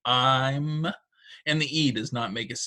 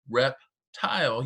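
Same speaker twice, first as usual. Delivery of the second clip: reptile stretched slowly, rep and tile drawn out; slow syllable demo; much slower than usual. Shown as a demonstration. Teacher slowly models reptile for students feeling syllables. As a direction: say reptile slowly so the chin drops twice. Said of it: "your chin touches your hand twice".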